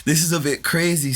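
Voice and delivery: mock-outraged, high-pitched thought; incredulous. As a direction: act out an exasperated inner reaction. Retells thought at crying girl.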